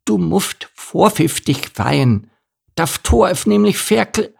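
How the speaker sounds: high, lisping donkey warning; Elimar, desperate. A narrator's reading. Little donkey warns about the gate, desperate high lisp. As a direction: plead urgently in a high lisp, cut off at the end. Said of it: "sagte Elimar verzweifelt".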